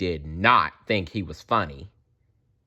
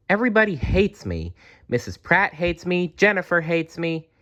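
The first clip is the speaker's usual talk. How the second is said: self-pitying; 9 semitones higher than usual. A narrator's reading. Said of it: "Timothy said to himself".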